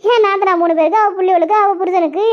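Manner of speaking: usual speaking voice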